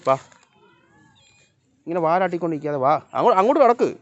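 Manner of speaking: loud, high scolding call; calling the dog. Real, not acted.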